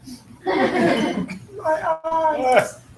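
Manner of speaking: loud amused laugh; a real laugh at the flop; burst of laughter. Real, not acted. Demo goes wrong, amused.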